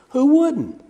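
high, amused "Who wouldn't?"; amused exclamation. Real, not acted. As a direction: toss off "Who wouldn't?" with a lift. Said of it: "repeats it softer".

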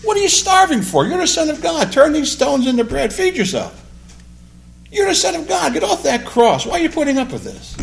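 very high, loud, goading tempter voice; Satan tempting. Retold or imitated. Satan tempting Jesus in the wilderness, goading. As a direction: goad and needle him, high and pushy. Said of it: "Just like Satan said".

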